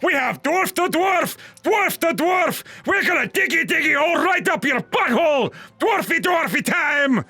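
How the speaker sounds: high-pitched, hammed-up Scottish yelling; Scottish dwarf shout. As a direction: holler like a rowdy Scottish dwarf. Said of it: "shouted in his best Scottish accent".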